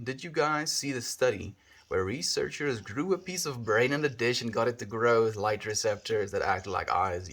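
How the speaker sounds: ordinary talk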